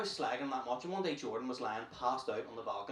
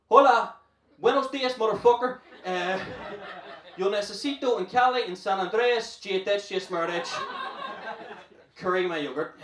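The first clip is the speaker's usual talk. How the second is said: loud, high, hammed-up bad Spanish; confidently botched Spanish; 6 semitones higher, 8 dB louder than usual. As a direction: belt out phrasebook Spanish with brash confidence. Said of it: "only bit of Spanish I knew".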